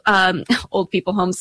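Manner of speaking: normal talking voice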